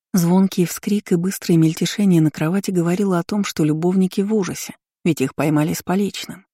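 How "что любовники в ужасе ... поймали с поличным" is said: ordinary delivery, nothing marked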